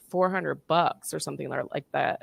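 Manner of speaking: ordinary talk, nothing marked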